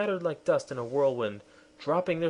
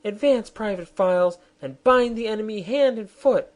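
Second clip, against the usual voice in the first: high, loud royal command; queen ordering her soldier forward; 10 semitones higher, 8 dB louder than usual. Acted. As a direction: bark the order to the private.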